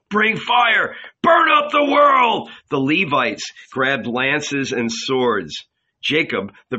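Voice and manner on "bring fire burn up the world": very high, loud frenzied cry; mob cry. A narrator's reading. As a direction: whip the crowd into a frenzy. Mob crying out.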